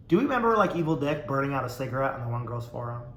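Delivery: ordinary delivery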